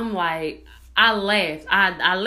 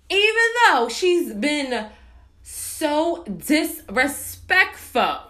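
slow, higher-pitched, word by word; indignant emphasis; 5 semitones higher, 3 dB louder, much slower than usual. Real, not acted. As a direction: drag it out with offended emphasis. Indignant about the rude caller.

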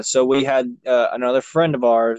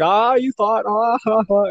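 mocking laugh; 9 semitones higher, slower than usual. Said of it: "and then he's like".

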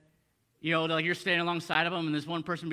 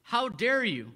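raised pitch, drawn-out mock outrage; indignant rebuttal; 5 semitones higher, 4 dB louder, slower than usual. Preacher mimics the Pharisees' rebuttal to the man, indignant. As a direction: hit DARE hard, as if affronted. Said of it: "That's their rebuttal".

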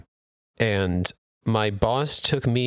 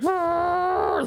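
roar. Retold or imitated. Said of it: "They're like".

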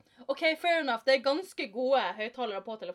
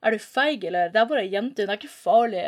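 much louder, goading delivery; loud taunt; 9 dB louder than usual. A narrator's reading. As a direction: egg your friend on loudly. Bill taunts Tom after shouting.

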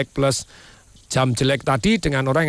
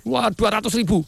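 high-pitched indignant tirade; acted anger; 12 semitones higher than usual. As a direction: victim protesting the robber's price. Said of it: "Marah orangnya". (pura-pura marah, nada tinggi)

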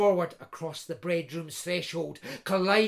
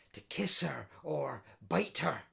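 plain reading voice

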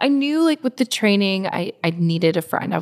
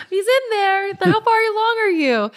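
very high imitation of gushing onlookers; retold crowd chatter, pitched way up; excited exchange; 13 semitones higher than usual. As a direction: exclaim it in a high, delighted pitch.

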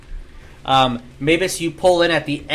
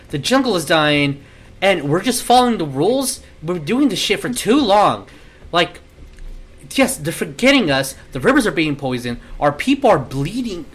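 impassioned, higher-pitched outburst; fed-up angry daughter; 3 semitones higher than usual. Acted. Alicia argues with her dad over failed negotiations, angry and fed up. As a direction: press each grievance in heated frustration. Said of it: "as Alicia, uh, is saying".

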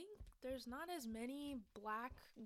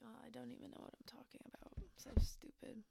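whispering; faster than usual. Real, not acted. Self-deprecating mumble.

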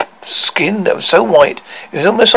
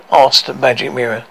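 ordinary delivery, nothing marked

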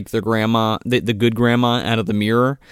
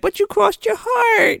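put-on much higher pleading child voice; whiny child. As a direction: plead like a betrayed little kid. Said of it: "And she's like".

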